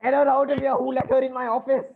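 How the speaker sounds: high, loud, hysterical yelling; enraged boss at breaking point; furious outburst. Acted. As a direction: roar the order in outrage. Banker furious, orders petitioner out.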